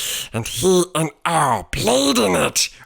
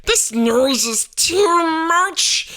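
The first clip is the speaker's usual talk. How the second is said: complaining others; 13 semitones higher, 3 dB louder than usual. A narrator's reading. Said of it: "said the others".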